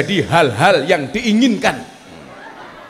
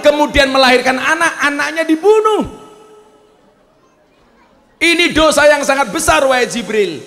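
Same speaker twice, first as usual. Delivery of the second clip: high, raised protesting voice; indignant Musa; 10 semitones higher than usual. Acted. Musa protesting to Jibril about the woman's sin, high and indignant. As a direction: insist on her great sin.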